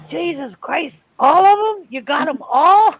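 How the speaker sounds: high, loud shocked outburst; astonished exclamation. Retold or imitated. Dad stunned to see the whole family, high loud disbelief. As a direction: blurt it out in disbelief.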